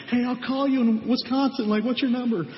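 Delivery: put-on high-pitched, eager chatter of kids swapping numbers; mock excited campers. Campers swapping phone numbers on the last night, mock excited. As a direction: imitate excited campers, pitched well above your own voice. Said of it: "they're excited 'cause it's the last night".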